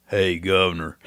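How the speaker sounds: a friendly call-out imitated from a car window; lightly voiced drawled hey governor; imitating the greeting. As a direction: toss out a casual greeting from the Hummer. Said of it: "That's exactly how they would say it".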